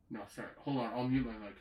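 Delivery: usual speaking voice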